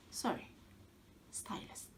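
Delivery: hushed, mocking correction; whispered sarcastic aside. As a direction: whisper it like a snide afterthought.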